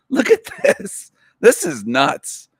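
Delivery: high, laughing disbelief. Real, not acted. Laughing at a meme he calls nuts. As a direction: crack up at how absurd it is.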